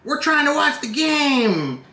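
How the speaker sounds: slow, high mock scolding; imitating a drunk fan telling someone off; annoyed fan. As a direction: drag out the hey like a drunk heckler.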